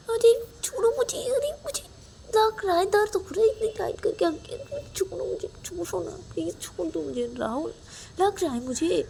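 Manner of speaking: high, whining cries of pain; squeezed too tight, whimpering in pain. Acted. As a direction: beg to be let go, hurting.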